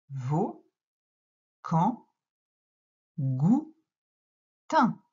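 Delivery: each syllable read out alone, evenly spaced; slow syllable reading. Shown as a demonstration. Teacher reading syllables aloud, one by one. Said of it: "La deuxième ligne".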